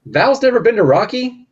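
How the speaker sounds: mock shocked. Real, not acted. Shocked Val never saw Rocky.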